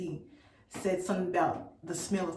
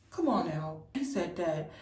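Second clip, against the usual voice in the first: ordinary delivery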